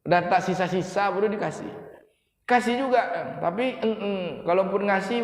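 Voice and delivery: ordinary talk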